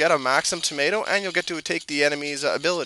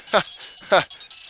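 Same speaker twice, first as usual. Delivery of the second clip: laugh; 5 semitones higher than usual. Real, not acted. Laugh after stone joke.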